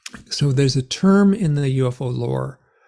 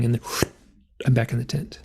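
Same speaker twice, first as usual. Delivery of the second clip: ordinary talk, nothing marked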